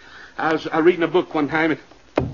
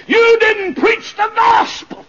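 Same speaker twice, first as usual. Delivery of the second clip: high, drawn-out accusing shout; full-force screamed accusation; screaming in hell; 7 dB louder, slower than usual. Acted. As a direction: cry it out loud and slow, accusing the preacher. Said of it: "members screaming and saying".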